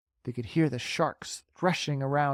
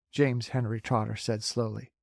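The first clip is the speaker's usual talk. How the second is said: ordinary delivery, nothing marked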